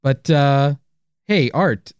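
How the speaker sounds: ordinary talk, nothing marked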